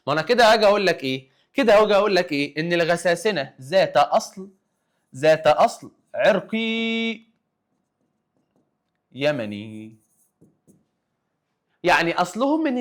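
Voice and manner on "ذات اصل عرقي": ذات اصل عرقي spoken very slowly, word by word; slow dictation. Shown as a demonstration. Teacher to class, dictating the key term slowly.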